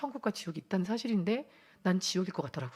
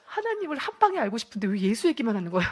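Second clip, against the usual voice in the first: loud, higher-pitched retold grumble; exasperated; 4 semitones higher, 8 dB louder than usual. Newcomer silently objecting to all the Jesus talk, exasperated. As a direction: replay an indignant inner complaint, amused. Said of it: "이게 너무 화가 나는 거예요".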